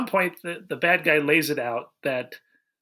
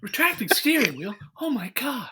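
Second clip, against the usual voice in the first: higher, far-off voice for the redo, gasping at the end; mock amazement; 7 semitones higher than usual. Shown as a demonstration. Mocking the movie hero, hammed-up astonishment. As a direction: overdo the astonished hero, with a cry on "Oh my God". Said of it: "No, not right".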